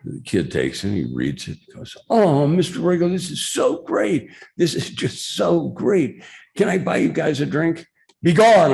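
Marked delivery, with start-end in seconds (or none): 2.1-7.9 very high, hammed-up fan gratitude; mock star-struck gushing, pitched way up; gushing thanks. Bar heckler thanks the columnist, gushing. Grateful heckler gushes over autograph. 8.2-8.7 high, loud mock-British dismissal; hammed-up British lordly cry; Mike Royko's semi-British accent. Columnist banishes gushing heckler, semi-British accent. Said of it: "speak in a semi-British accent".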